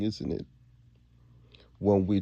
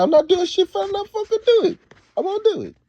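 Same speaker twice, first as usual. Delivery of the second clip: much higher, louder put-on voice of a stubborn son; mocking son's voice; 7 dB louder, faster than usual. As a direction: squeal the refusal like a defiant kid. Son quoted refusing, put-on voice.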